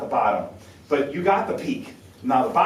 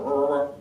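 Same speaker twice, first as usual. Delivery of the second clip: mock compacted sixteenths. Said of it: "it sounds like".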